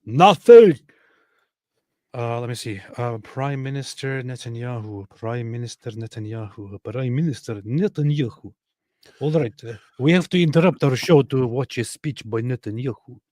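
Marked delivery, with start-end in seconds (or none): 0.0-0.8 one word barked out much louder and higher; sudden shouted punch; emphatic shout. Real, not acted. Insisting nothing else matters. 6.8-13.2 mock Russian accent announcing the Prime Minister; playful hammed-up Russian announcer voice. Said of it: "I don't know why I'm doing a Russian thing".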